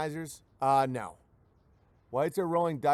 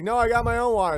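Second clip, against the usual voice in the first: louder, higher mock runner refusing help; proud runner; 7 semitones higher, 7 dB louder, faster than usual. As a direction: brush off the offer like a proud runner. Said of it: "And they're like".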